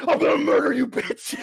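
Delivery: high, loud mock shout; mock murderous rage. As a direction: burst into an imitated monster's rage. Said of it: "then it immediately goes into".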